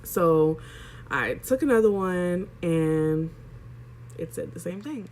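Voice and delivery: usual speaking voice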